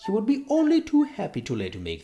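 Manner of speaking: high, loud, smooth-talking pig; Squealer persuading. Acted. Squealer persuading the farm animals.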